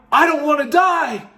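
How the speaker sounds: frightened. Retold or imitated. Retold terror of dying.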